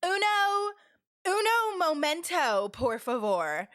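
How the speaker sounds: slow, Americanized Spanish with a high start; drawn-out mimicked American Spanish, falling at the end; Spanish in English accent. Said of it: "Spanish said in an English accent".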